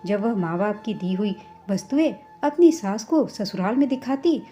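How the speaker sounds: ordinary delivery, nothing marked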